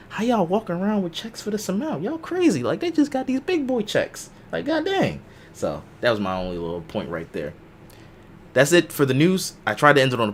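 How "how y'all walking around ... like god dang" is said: much higher, incredulous squeal of a voice; mock amazement pitched way up high; acted disbelief. Bidder marveling at rich rivals' auction checks, high-pitched mock disbelief. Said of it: "So I'm just like".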